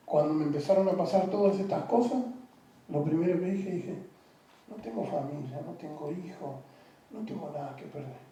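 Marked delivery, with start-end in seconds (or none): 4.7-8.3 a hushed, much softer, hopeless inner voice; retold despair. A man recalling his own suicidal thoughts, hushed and hopeless. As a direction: murmur it like someone who has given up.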